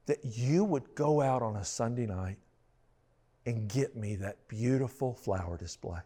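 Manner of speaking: soft, slow, close to tears; hushed and deeply touched; moved to tears. Retold or imitated. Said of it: "she kinda had some tears in her eyes".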